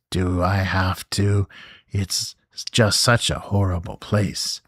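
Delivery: ordinary delivery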